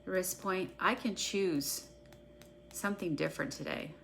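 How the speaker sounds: a guided EFT tapping script read for followers; guided tapping meditation. Acted. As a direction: call each tapping point, then the affirmation, at an even unhurried pace. Leads a guided tapping session.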